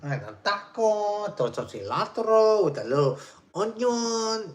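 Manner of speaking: imitating a Mexican guy with a heavy Mexican accent, high and loud; playful Mexican accent impression; mock Mexican accent. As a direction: put on a hammed-up Mexican accent. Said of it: "You know, he's like".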